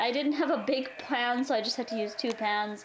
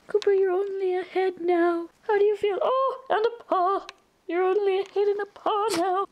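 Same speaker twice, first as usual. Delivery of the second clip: high, sing-song pet voice; cooing at the dog; 7 semitones higher, 6 dB louder than usual. Real, not acted.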